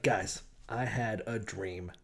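mock-solemn, understated announcement; hushed dream announcement. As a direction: draw it out softly, like a big reveal. Acting out Joseph announcing his dream.

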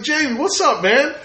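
very high, excited fan greeting; reenacted gushing hello; overfamiliar greeting. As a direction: hail a star like an old buddy. Reenacts greeting his movie idol.

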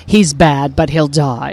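low, loud, flat woman's voice; crowd woman voice. Acted. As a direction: deliver it deadpan, low and loud. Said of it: "a woman who comes out of the crowd and says".

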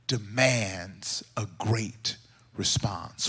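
hushed, low and deliberate; solemn preaching. Real, not acted. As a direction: deliver it gravely, softly, with long pauses. Solemn delivery of the lesson.

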